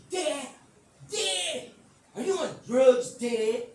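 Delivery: loud, high mock yell; imitating an appalled daughter, stretched and loud; exasperated daughter. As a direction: yell at Dad in disbelief. Acting daughter confronting her dad.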